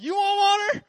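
hammed-up, very high furious yell; exasperated Moses shout. Pastor admitting he acts like Moses, hammed-up angry shout. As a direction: bellow it, mocking your own temper.

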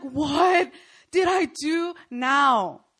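slow, loud, put-upon whine; exasperated. Retold or imitated. Intern fed up with the yelling boss. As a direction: drag it out, fed up.

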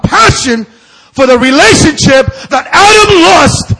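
preaching shout. Real, not acted. Preacher crying out about what Adam lost, a loud slow proclamation. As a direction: proclaim it at full force.

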